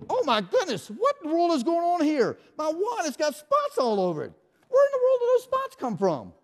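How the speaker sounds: high, loud, over-the-top astonishment; mock gasp at the spots; hammed-up surprise. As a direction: play shocked for the children. Mock shock at spotted wand.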